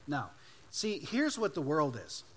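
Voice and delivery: usual speaking voice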